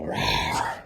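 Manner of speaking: low playful rawr; a cute low growl for the baby; half-hearted low rawr; mock cheetah roar. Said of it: "I think they go".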